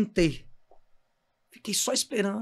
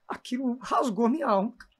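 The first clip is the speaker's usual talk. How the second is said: usual speaking voice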